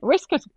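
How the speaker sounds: "Whisket" high, with a short i for waistcoat; Irish pronunciation. Retold or imitated. Repeating Irish grandma's word, "Whisket" high with short vowels. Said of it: "the one word that had stayed Irish".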